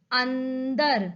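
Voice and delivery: अंदर slowly, with a long n; nasal anusvara. Shown as a demonstration. Teacher showing where the anusvara sounds, अंदर drawn out. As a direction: stretch the n in अंदर before दर. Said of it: "उसी अक्षर को वैसे उच्चारण".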